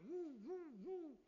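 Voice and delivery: high, loud "woo" gusts; imitated wind whoosh. Preacher mimicking the powerful tornado, high imitated wind whoosh.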